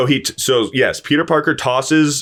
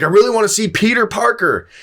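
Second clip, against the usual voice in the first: mock insistent wish; 10 semitones higher, faster than usual. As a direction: wish harder, playfully hammed up. Second, stronger wish opens portal.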